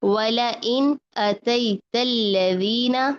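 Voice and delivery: slow, measured tajweed with drawn-out vowels; Quran recitation. Shown as a demonstration. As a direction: recite verse fragments slowly, holding each L light. Recites verses as models.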